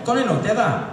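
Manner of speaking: high mock Glaswegian, rattled off; thick Glaswegian. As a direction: imitate a Glasgow friend repeating herself. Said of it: "they got thick Glaswegian accents".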